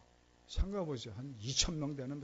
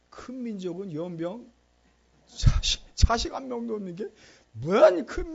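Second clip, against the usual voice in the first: indignant shout; 4 semitones higher, 10 dB louder than usual. Acted. Isaac protesting loudly in prayer.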